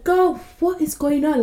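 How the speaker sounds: high, exasperated mock scolding; upset reader. Reader fed up with the main character, upset. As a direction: scold a character as if she could hear. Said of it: "I'm getting upset at the main character".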